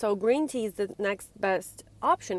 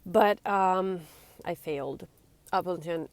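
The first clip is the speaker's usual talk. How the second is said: ordinary delivery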